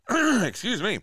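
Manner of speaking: throat clearing, husky; frog in throat. Real, not acted. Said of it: "I had a frog in my throat".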